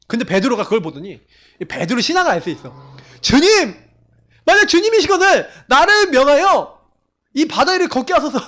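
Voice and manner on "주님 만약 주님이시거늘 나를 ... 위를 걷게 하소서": calling out. Acted. Peter calls out to Jesus.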